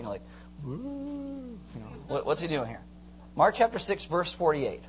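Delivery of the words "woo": high, soft drawn-out woo; quiet comic whoosh noise; imitated woo. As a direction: float out a playful ooh. Imitates Jesus out on the lake.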